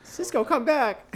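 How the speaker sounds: high, slow coaxing call; sing-song call. Real, not acted. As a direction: coax him back sweetly. Calling the dog again.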